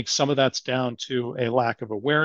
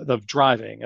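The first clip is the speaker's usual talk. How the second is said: normal talking voice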